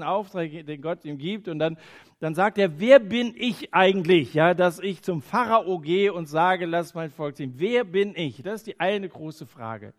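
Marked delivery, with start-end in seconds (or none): 2.8-4.3 Moses. Acted. Moses protesting to God. 4.3-8.4 Moses. Acted. Moses doubting his calling, voiced.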